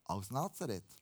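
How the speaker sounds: ordinary talk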